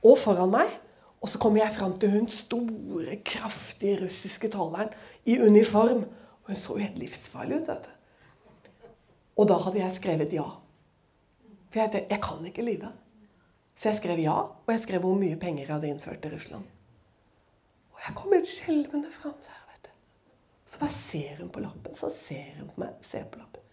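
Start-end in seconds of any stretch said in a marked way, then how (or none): 1.2-8.9 suspense whisper. Real, not acted. Teller whispering about the scary customs officer, hushed. 17.9-23.7 whispered and much softer; fearful whisper. Real, not acted. Teller whispering her fear at the customs desk, hushed.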